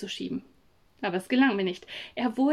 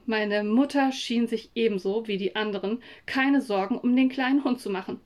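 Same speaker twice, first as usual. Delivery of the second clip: usual speaking voice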